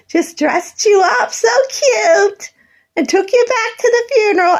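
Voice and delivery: near tears, slowed down. Real, not acted. Recalling being dressed up for the funeral, tearful and high. Upset retelling of childhood.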